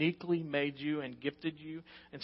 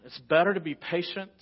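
normal talking voice

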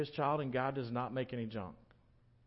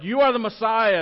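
much louder, higher confident declaration; bold proclamation; 8 semitones higher, 12 dB louder than usual. Retold or imitated. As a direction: proclaim it boldly. Peter retold boldly claiming.

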